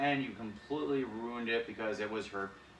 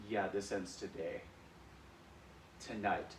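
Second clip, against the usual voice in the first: low, quieter sinister vow; a hint of menace; 3 semitones lower, 7 dB quieter than usual. Jealous [name] vowing revenge, low and sinister. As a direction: mutter it like a villain. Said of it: "And I was like".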